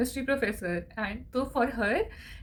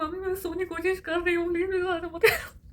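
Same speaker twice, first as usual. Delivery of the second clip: higher, crying and begging; tearful plea; 8 semitones higher, faster than usual. Retold or imitated. Daughter crying at mom to stop lulling her. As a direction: whimper it through tears.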